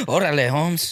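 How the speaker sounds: put-on laughing Chicano accent, "Orale, Holmes" drawn out; chuckling Chicano slang greeting. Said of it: "Do that again?".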